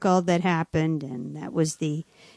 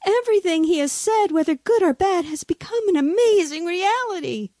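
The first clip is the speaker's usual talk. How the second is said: high, agitated exclamation; frightened onlookers; 10 semitones higher than usual. A narrator's reading. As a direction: blurt it in theatrical alarm. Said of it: "were frightened and disturbed".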